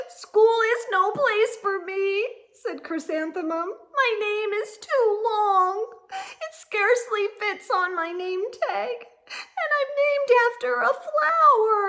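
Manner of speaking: put-on high, loud wailing child; whiny complaint. A narrator's reading. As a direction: plead your misery to your parents.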